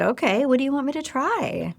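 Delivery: bright-eyed, bushy-tailed eagerness; bright-eyed and eager. Retold or imitated. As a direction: chirp it like a keen, naive patient. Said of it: "All bright-eyed and bushy-tailed".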